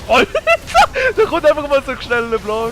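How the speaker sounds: loud, high, laughing outburst; excited shout. Real, not acted. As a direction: blurt it out in shock and glee. Sudden block in a game.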